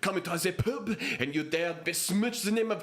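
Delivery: high, indignant French outrage; French accent. Acted. Frenchman in the pub defending football, high indignant French accent. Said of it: "and I'll do French".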